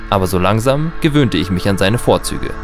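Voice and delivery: ordinary talk, nothing marked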